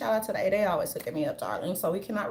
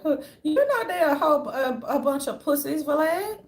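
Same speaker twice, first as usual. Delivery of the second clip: higher, louder mock Valet rant; 6 semitones higher, 5 dB louder than usual. Speaker mocking Valet talking to his viewers, higher and louder. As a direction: ham up a rival sneering at him. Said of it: "Valet probably like".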